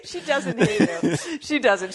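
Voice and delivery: slow, laughing reassurance. Real, not acted. Reassuring co-host that Stacy doesn't hate him, slow and laughing. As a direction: laugh it out slowly, insisting.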